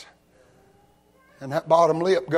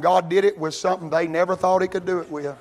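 usual speaking voice

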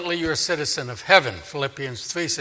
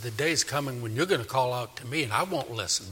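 soft, grave warning; hushed, serious pronouncement; 3 semitones lower, 8 dB quieter than usual. Acted. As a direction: warn them quietly and seriously. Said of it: "God gets very serious".

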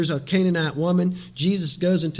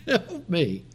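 softer acted plea, falling away; pleading; 4 dB quieter than usual. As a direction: repeat the plea in a smaller voice. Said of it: "She keeps pleading to him".

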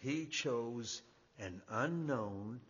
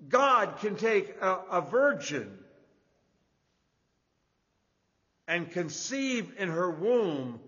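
loud, raised preaching voice with weighty pauses; emphatic preaching; 8 semitones higher, 13 dB louder than usual. Real, not acted. Preacher telling the congregation of the promised son, emphatic preaching. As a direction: drive each phrase home to the congregation.

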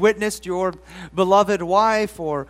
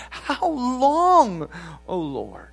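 with passion and despondency; 4 semitones higher, much slower than usual. Shown as a demonstration. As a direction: plead it like a man at the end of his hope. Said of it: "read it with passion, with despondency".

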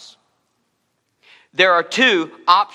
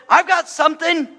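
put-on much higher, eager little boy; 12 semitones higher, 3 dB louder than usual. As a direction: pipe up like an excited small kid. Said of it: "here comes a little boy and says".

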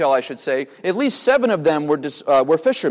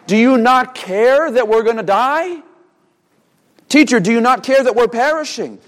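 mild mock panic, pitched higher; panicked accusation; 6 semitones higher, 3 dB louder than usual. Preacher voices disciples accusing Jesus, mildly panicked accusation. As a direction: demand it as a higher-pitched, half-acted accusation. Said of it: "that accusation in the midst of panic".

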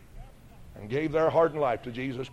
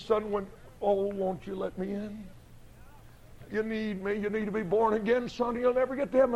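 a light, softer, gentle voice of God; voice of the Lord; 5 semitones higher, 3 dB quieter than usual. Acted. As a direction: plead softly as the Lord calling a son. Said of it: "the Lord says".

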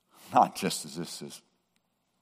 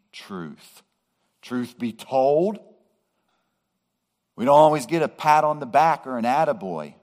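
higher and louder, pleading with the flock; impassioned preaching; 7 semitones higher, 5 dB louder than usual. Acted. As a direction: lean in and preach with feeling. Preacher empathising, voice raised.

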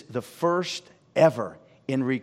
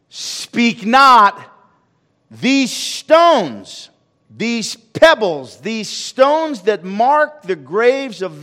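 rising, louder sermon build on the stones; preaching at full force; 7 semitones higher, 8 dB louder than usual. Acted. Preacher building to the climax before the congregation, full-force preaching. Preacher retells Jesus' entry.

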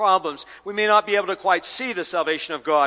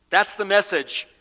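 ordinary talk, nothing marked

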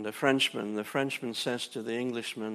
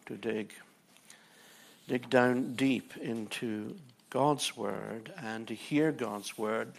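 ordinary delivery

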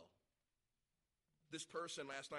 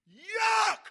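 very high, hammed-up shout; comic full-force "yuck"; disgusted yell; 27 dB louder than usual. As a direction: blast out mock revulsion at the taste. Acted disgust at castor oil.

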